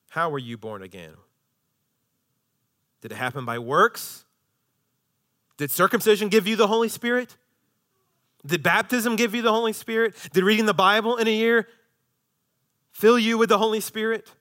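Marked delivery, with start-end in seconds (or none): 5.6-14.3 high, pressing rhetorical questions; insistent preaching. Acted. Preacher challenging the Galatians as Paul, insistent preaching. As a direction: fire raised questions at the congregation.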